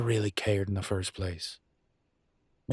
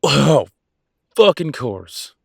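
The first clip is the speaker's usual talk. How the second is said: loud, higher-pitched flare of anger; exasperated outburst; 6 semitones higher, 11 dB louder, much slower than usual. Acted. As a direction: burst out in exasperation. Exasperated outburst at partner's objection.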